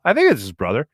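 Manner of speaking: usual speaking voice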